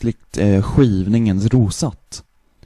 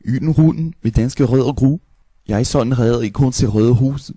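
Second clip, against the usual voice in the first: Danish-sounding gibberish; fake Danish. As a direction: babble like Danish. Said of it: "we do the Danish".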